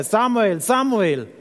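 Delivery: calling out. Acted. The Lord comes to Samuel and calls, calling out. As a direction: call the boy, each name falling away. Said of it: "rief wie vorher".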